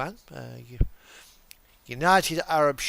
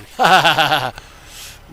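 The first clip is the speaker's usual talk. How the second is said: loud real laugh; 8 dB louder than usual. Real, not acted. Laughing at truck plate.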